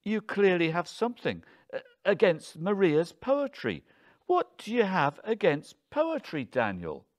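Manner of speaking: high, loud, exasperated challenge; affronted wife demanding answers; indignant accusation. A narrator's reading. Wife accuses husband in quarrel.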